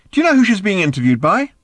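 high, loud astonished question; calling out. Acted. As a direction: shout the question through to the next room. Arthur calling from next room.

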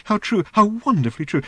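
high, gushing little robot. Acted. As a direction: swoon over the words.

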